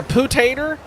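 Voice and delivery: poo-tater said "POO-tay-ter", with an oo in the first syllable; poo-tater in a mock Southern drawl. Shown as a demonstration. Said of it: "two different ways I can pronounce that".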